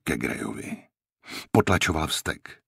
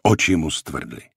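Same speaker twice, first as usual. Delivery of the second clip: ordinary delivery, nothing marked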